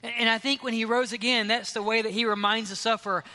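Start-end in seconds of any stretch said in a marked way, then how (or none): none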